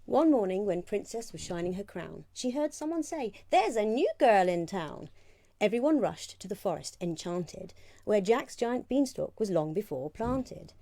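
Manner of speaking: lively rhyming read-aloud; storybook reading. Acted. Reads picture book aloud.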